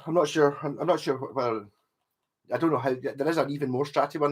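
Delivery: ordinary talk, nothing marked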